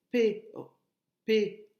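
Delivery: "perroquet" in loud, separated pe, ro, quet; syllable by syllable. Shown as a demonstration. Teacher showing where ro falls, "perroquet" syllable by syllable. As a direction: restart on "pe-", then spell out each syllable of "perroquet". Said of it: "trois syllabes".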